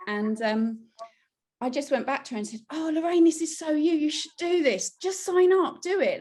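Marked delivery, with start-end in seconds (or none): 2.7-6.2 higher and louder, gushing; eager encouragement. Retold or imitated. Urging her friend Lorraine to sign up, excited. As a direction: cheer your friend on to sign up.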